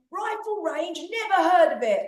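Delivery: high-pitched imitation of an online commenter; mock dismissive.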